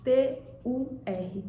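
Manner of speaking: T U R spelled slowly letter by letter; spelling out letters. Shown as a demonstration. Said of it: "a gente tem turma".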